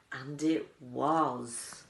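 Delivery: usual speaking voice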